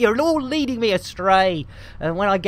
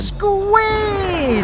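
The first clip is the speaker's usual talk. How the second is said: excited greeting; 11 semitones higher than usual. Acted. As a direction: sing out the name happily. Loud greeting to viewer.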